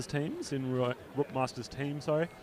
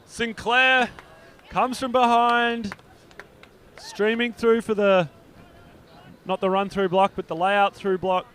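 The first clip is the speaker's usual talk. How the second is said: rising play-by-play call, higher and louder; excited; 7 semitones higher, 7 dB louder, slower than usual. Real, not acted. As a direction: call the layout block at full excitement. Exciting layout block play call.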